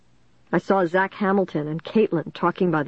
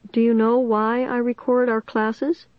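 thin, higher-pitched teacher voice; Mister Northwood's thin high voice; 5 semitones higher than usual. A narrator's reading. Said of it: "in his thin, high voice".

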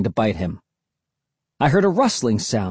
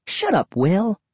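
higher-pitched irritated retort; snapping; 9 semitones higher, slower than usual. A narrator's reading. Cassie silences Will's mockery, snapping. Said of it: "Cassie snapped".

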